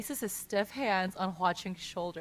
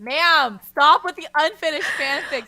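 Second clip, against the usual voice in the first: louder playful scold; mock exasperation; 5 semitones higher, 8 dB louder than usual. Real, not acted. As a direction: tease her for unfinished fics.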